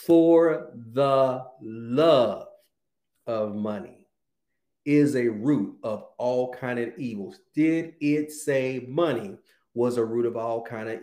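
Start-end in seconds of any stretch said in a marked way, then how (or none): 0.0-7.4 very slow, word by word, with long pauses; deliberate, drawn-out scripture reading. Shown as a demonstration. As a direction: read it slowly, leaning on LOVE. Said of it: "I'm gonna read it slow this time".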